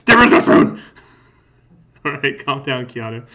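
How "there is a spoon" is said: loud, high, rasping shout of a spoon; mock shout. Player shouting back at Keanu in the game, mock yelling. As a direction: yell it out as a joke Keanu.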